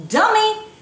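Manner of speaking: sudden high shouted insult; furious chef. Pretend. Scolds viewer for using cooked nuts.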